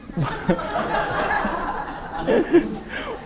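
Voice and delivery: high unvoiced burst of laughter; big laugh. Real, not acted. Class laughs at the joke. (높고 숨섞인 큰 웃음)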